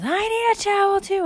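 put-on very high, loud kid voice; acted high-pitched plea; freaking-out little girl. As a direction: wail urgently as a panicked girl. Candy freaking out.

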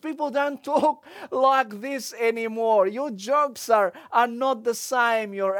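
ordinary talk, nothing marked

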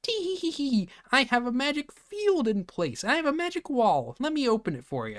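very high, squeaky gnome voice; giggly gnome. Retold or imitated. Gnome taunts Korra about his magic wall, giggly and high-pitched. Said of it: "And then the gnome's like".

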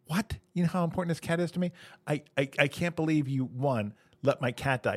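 mock-appalled, high start that settles; shocked disbelief. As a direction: react aghast to terrible news, easing down. Owner learns his cat died.

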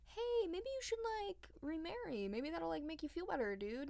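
high, soft mock courtier; kingsmen's voice. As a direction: coax the grieving king lightly. Kingsmen voiced coaxing the king.

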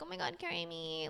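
high, soft mocking whimper; mock helpless girl. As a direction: imitate a helpless girl begging quietly. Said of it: "that girl who's like".

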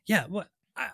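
tripping over words, stammering; stammer, lost for words; stuttering. Real, not acted. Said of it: "I'm stuttering here".

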